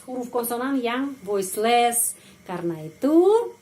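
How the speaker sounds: ordinary talk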